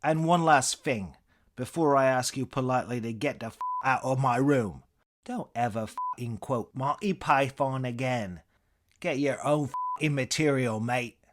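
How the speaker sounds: irate British send-off, high and loud; fed-up English comedian's parting blast; Eric Idle. Acted. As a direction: order them out in an angry British accent. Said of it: "impression of Idle's Cambridge-tinged inflections".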